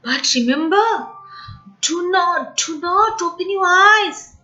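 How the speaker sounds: a mouse's solemn bedtime warning; earnest warning. Acted. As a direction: warn her gently but firmly. Mouse warns girl solemnly.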